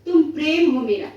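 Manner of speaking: declaimed in short phrases with pauses; poem recitation. Acted. Poet addressing poetry itself as love and courage, declamatory recitation.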